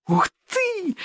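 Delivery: high thrilled squeal; giddy party girl. A narrator's reading. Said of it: "взвизгнула девчонка". (восторженный визг)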